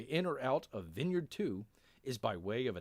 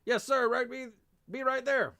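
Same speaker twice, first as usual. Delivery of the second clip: high, loud shouted reply; yelled acknowledgement to an officer; yelling back; 12 semitones higher, 6 dB louder than usual. Acted. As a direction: shout an obedient answer across the distance. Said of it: "yells back".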